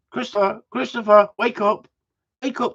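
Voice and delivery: nurses calling. Retold or imitated. Nurses calling him awake.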